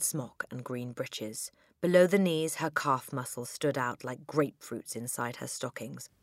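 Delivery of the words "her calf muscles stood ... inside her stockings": ordinary delivery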